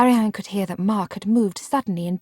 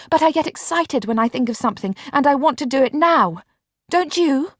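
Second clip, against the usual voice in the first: fast, higher, eager; undismayed; 6 semitones higher, 4 dB louder than usual. A narrator's reading. Marianne to Mark, eager and undismayed. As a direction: bubble on cheerfully.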